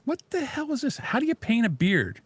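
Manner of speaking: shoots up high in startled disbelief; what on earth, high and incredulous; surprised squeal. Real, not acted. Shocked at beard paint option.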